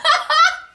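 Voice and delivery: a short, high, loud burst of laughter; giddy laugh. Real, not acted. Reader laughing at her magic cards, high giddy laugh. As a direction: laugh out, thrilled and amazed.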